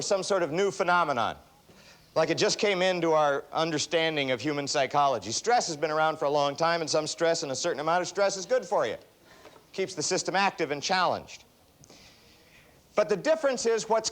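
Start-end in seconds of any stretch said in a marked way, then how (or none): none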